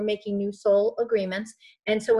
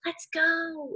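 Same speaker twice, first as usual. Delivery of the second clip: higher, upbeat rallying cry; cheerleader; 7 semitones higher, 5 dB quieter than usual. Acted. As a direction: pump them up like a cheerleader. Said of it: "Your higher self is like".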